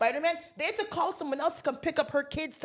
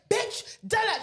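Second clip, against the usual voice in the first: much higher mock tirade; mother's outburst; 9 semitones higher, slower than usual. Drunk mother getting in his face, aggressive. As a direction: imitate a high-pitched, aggressive insult.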